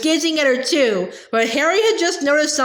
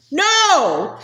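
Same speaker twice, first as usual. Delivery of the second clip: a desperate No with the voice cracking, pitched up; cracked shout; 4 semitones higher than usual. A narrator's reading. Hermione shouting No as Umbridge raises her wand, cracked voice. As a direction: shout it as the voice breaks. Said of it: "shouted Hermione in a cracked voice".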